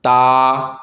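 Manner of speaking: ता with the aa drawn out long; long vowel. Shown as a demonstration. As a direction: hold and stretch the vowel of ता. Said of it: "खींच के पढ़ेंगे".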